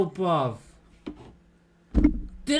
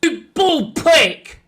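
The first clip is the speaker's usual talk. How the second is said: angry; 6 semitones higher, 5 dB louder, slower than usual. Real, not acted. Insulting referee.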